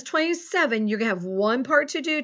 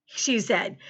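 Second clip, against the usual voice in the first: ordinary talk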